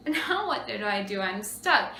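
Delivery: ordinary talk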